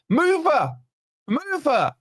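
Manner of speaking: high, urgent yell of move her; mock chant from the chat; shouted demand. Shouting at the host to move his sleeping daughter.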